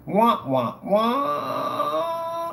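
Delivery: "wah, wah, waaah" with last note held high; taunting drawn-out wah-wah; mock sad trombone. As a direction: imitate a loser's jingle. Mocks a lost claim.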